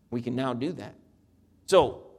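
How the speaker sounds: ordinary talk, nothing marked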